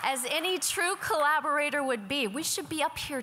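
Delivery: high, loud and eager; excited. Real, not acted. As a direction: lift it up with excitement. Excited after finding Amy.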